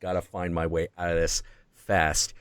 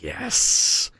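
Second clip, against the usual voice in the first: hissed, whispery yes; sinister whispering demon; demon's voice; 2 semitones lower, 4 dB louder than usual. Retold or imitated. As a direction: hiss it out like a demon in someone's head. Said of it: "Then I hear the voice".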